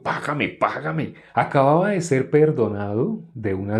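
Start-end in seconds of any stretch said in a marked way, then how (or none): 0.0-1.1 slow, lightly acted demand from a creditor; creditor demanding payment. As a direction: press for payment, drawing the words out. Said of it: "lo cogió del cuello".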